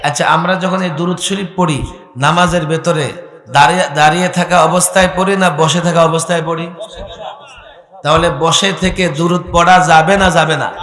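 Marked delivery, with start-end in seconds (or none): none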